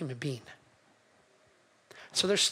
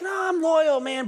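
mock protest; 7 semitones higher than usual. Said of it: "you're out there and you're like".

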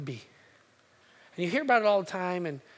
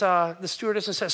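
ordinary delivery

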